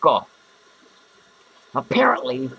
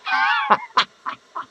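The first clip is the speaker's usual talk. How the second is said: high-pitched cackle; high, squealing burst of laughter; cackling laugh. Acted. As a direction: cackle high at your own joke. Laughs at own joke.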